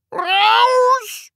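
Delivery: high, long-drawn enraged yell; all-out furious scream. Acted. Teacher at gleeful pupil, screaming at full pitch. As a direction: scream it out at the very top of your voice. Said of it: "schrie Herr Groll noch lauter".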